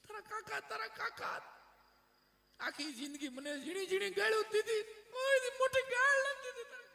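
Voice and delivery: high comic widow's wail, swelling to shouts; mock weeping. Widowed aunt defending her thieving late husband, mock weeping. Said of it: "રોતા રોતા કાકીએ કીધું".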